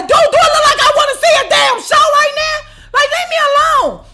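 blowing up at Oz; exasperated shout. Retold or imitated. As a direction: yell it in his face. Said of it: "I'm looking at him like".

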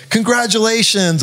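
high, chirpy congratulations; sing-song mock praise; mock cheerful. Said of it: "people I went to high school".

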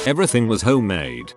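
plain reading voice

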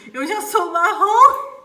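slow, loud words breaking into laughter; laughing loudly. Real, not acted. Laughing at the bridesmaid's joke. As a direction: crack up at the joke. Joke about her skin.

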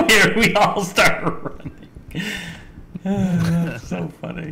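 high, loud laughter breaking through the words; laughing hard. Real, not acted. Player amused that everyone starts running, laughing hard. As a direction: crack up while talking, stretching the words.

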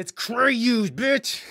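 high, loud brag; triumphant boast. Pretend. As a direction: shout the name like a victory.

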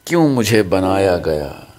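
slow, measured Urdu verse reading; ghazal recitation. Real, not acted. Poet's son reciting his father's ghazal to friends, measured recitation. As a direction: recite poetry with weighted pauses.

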